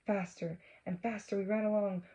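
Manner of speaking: usual speaking voice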